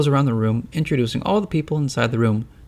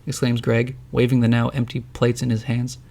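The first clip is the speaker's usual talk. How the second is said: usual speaking voice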